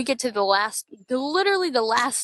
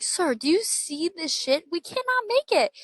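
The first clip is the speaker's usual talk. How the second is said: high, pleading whine rising higher; mock pleading; 9 semitones higher than usual. Begging the bus driver to wait, high pleading whine. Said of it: "I'm like".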